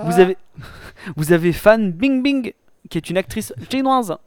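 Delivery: hammy put-on Chinese accent. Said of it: "Je vais arrêter de faire les accents".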